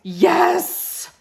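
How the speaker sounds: a high, loud joyful yes; excited. Real, not acted. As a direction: cheer the answer. Praising a right answer.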